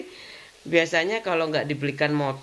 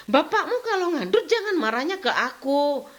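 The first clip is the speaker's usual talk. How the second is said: mock angry retort; 8 semitones higher than usual. Retold quarrel with husband.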